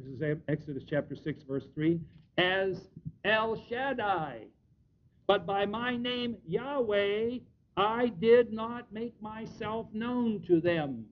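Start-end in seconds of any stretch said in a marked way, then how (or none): 2.4-11.1 very slow, deliberate reading, higher in pitch; emphatic scripture reading. Acted. Preacher proving his point from Exodus, slow emphatic scripture reading. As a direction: read the verse out word by weighty word. Reads scripture aloud with weight.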